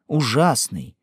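ordinary delivery, nothing marked

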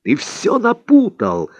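high, drawn-out indignation; indignant. A narrator's reading. Don Juan correcting his pupil's mistake, indignant. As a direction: protest with pitch lifted, stretching the words. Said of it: "возмутился Дон Хуан".

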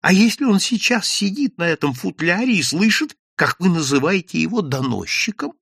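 высокий встревоженный упрёк; одёргивай болтуна, будто донос уже слышат; испуганное шиканье. A narrator's reading. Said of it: "зашикал Евсей Львович". (испуганно шикая, высоким голосом)

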